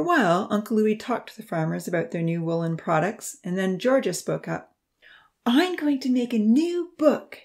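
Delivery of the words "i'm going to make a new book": put-on higher, slow, eager child's voice; bursting with a new idea, drawn out; excitedly. A narrator's reading. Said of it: "she said excitedly".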